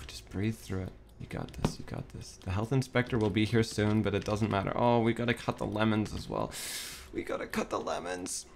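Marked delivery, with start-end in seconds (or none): 0.1-2.4 hushed, half-whispered pep talk; calm yourself down under your breath; self-soothing. Real, not acted. Calms self down after panic. 7.1-8.5 soft, high little voice; hushed self-reminder. Real, not acted. Repeats the task to self, softly.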